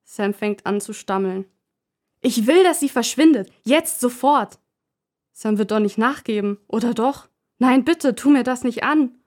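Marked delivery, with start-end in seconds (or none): 2.2-4.6 furious demand. Acted. Leonie fordert Puppe weg. 7.6-9.2 loud plea. Acted. Sam fleht Leonie an.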